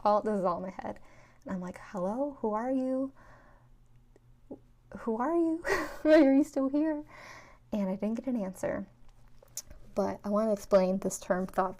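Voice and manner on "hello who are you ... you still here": higher, louder bewildered call. Retold or imitated. Asking the voice in her head, bewildered and calling out. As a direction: call out, half laughing, baffled.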